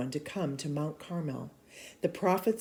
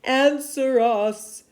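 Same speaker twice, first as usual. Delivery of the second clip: slow, louder, higher plea; pleading shout; 8 semitones higher, 9 dB louder, much slower than usual. Acted. Prophets begging Baal for fire, loud and slow. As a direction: beg loudly for an answer. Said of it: "they shouted".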